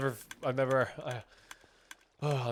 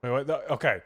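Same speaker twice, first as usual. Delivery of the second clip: ordinary delivery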